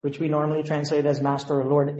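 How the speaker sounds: normal talking voice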